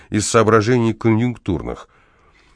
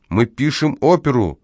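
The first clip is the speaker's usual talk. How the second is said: mildly wailing, higher-pitched complaint; wailing protest; 6 semitones higher than usual. A narrator's reading. Opera writer protesting about the horse next door, mild wail. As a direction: complain in a restrained high wail. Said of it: "завопил Ляпис".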